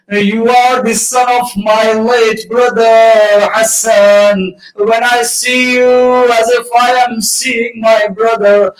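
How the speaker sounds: rising, slow mourning chant of the Imam's words; lamenting recitation. Acted. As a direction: let the grief swell into a sung wail.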